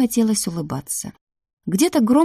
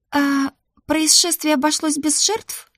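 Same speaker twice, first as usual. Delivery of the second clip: high, tentative question; timid; 9 semitones higher than usual. A narrator's reading. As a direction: ask hesitantly in a lifted voice. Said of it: "несмело поинтересовалась я". (несмело, тонким голосом)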